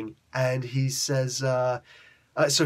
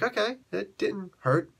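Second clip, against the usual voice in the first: tentative relief; 8 semitones higher than usual. Acted. Surfer tests himself after the fix.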